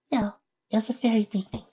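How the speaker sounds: high, soft shy pony voice; small timid mare voice; Fluttershy voice. Acted.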